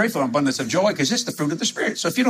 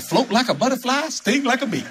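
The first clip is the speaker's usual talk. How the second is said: boxer's boast; 7 semitones higher than usual. Retold or imitated. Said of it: "Oh, I said".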